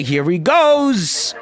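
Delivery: very slow, drawn-out build-up; anticipation. Real, not acted. The player watching Apollo's launch. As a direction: draw it out, holding breath.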